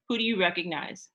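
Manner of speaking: ordinary talk, nothing marked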